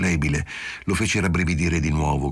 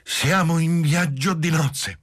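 put-on much higher, slow challenging drunk wife; defiant; 11 semitones higher, slower than usual. A narrator's reading. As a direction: throw it down as a challenge. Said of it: "disse in tono di sfida".

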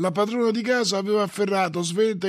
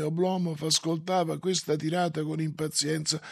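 ordinary delivery

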